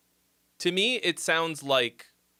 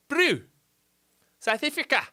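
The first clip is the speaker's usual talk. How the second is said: put-on South African accent; 11 semitones higher than usual. Greeting South African viewer in accent.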